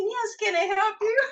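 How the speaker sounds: high, chirpy little clerk; high mock voice of the little service clerk. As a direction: squeak it like a tiny eager service guy. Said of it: "little tiny skinny little guy".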